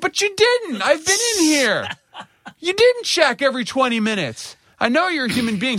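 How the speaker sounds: high, strained indignant protest; retelling a furious protest to the nurse, high and strained; exasperated retort. Retold or imitated. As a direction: push back in high outrage.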